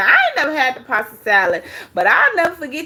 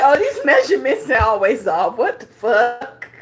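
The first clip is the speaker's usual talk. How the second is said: laughing disbelief. Real, not acted. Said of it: "I said".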